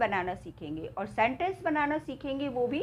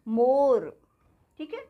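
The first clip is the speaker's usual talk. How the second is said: ordinary talk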